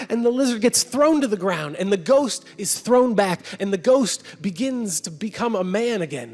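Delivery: ordinary delivery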